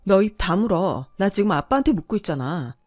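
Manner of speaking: loud, sharp order to shut up; wife silencing husband, loud angry shout. Acted. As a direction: cut him off with a furious yell. Wife snaps at interrupting husband.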